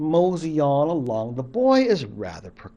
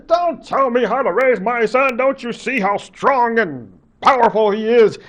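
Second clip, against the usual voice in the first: higher and louder, boastful father voice; proud father; 7 semitones higher, 5 dB louder than usual. Acted. Father telling mother not to interfere, proud and raised. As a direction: puff up and defend your son, a little raised.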